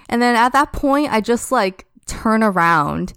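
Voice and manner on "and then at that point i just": usual speaking voice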